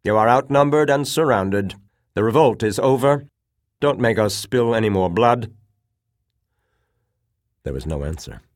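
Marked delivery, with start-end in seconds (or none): 0.0-5.5 loud, high, commanding proclamation; ringing shout. A narrator's reading. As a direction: project a surrender demand through the hall. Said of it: "his voice ringing clearly through the hall".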